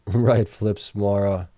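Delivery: normal talking voice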